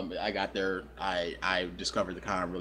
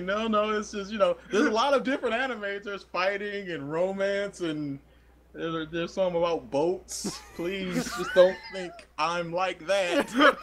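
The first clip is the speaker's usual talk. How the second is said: pleading laugh; 10 semitones higher than usual. Retold or imitated. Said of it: "It was like".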